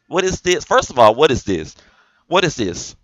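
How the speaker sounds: loud, incredulous demand; exasperated. Real, not acted. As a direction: demand an explanation, fed up. Exasperated rant at a video.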